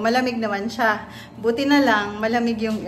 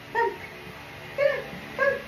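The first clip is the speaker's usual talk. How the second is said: soft coaxing; 13 semitones higher, 6 dB quieter, slower than usual. Real, not acted. Coaxing scared puppy.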